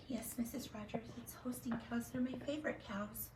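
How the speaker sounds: put-on tiny scared child whispering; timid whisper. A child timidly whispers to the teacher.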